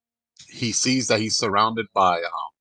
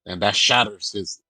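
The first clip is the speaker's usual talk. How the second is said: usual speaking voice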